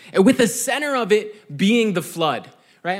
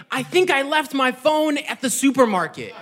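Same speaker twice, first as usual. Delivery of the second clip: higher-pitched imitation of a panicking mom; mock panic; 6 semitones higher than usual. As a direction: play mom's sudden panic for laughs. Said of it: "just start panicking".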